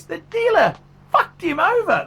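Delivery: real outburst of rage, loud and high; furious shout. Real, not acted. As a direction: yell it out in full anger, pitched high. Angry rant about father's trade.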